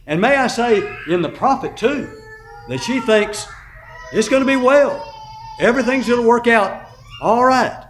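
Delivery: loud, ringing sermon delivery; preaching at full force. Acted. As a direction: preach it to the back row. Preacher rises again.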